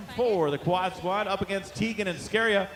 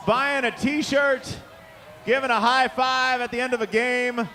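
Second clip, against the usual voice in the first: loud, upbeat PA thank-you; arena announcer; 5 semitones higher, 5 dB louder than usual. Real, not acted. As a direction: address the whole crowd. Closing announcer address to crowd.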